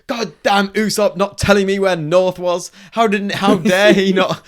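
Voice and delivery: high, loud indignant whine; hammed-up fury at a friend; mock outrage as Luffy. Friend retelling Luffy's rant at Usopp, high and loud. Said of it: "he's just going".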